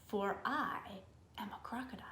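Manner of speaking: whispered, hushed crocodile reveal; sly crocodile. Acted. Crocodile whispers to the little elephant who he is. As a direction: hiss the secret softly.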